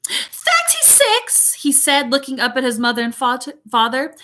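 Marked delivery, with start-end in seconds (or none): 0.1-1.5 high, drawn-out bratty British whine; appalled child, very slow and high; dismayed spoiled boy. A narrator's reading. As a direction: gasp it out in high-pitched dismay. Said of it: "His face fell".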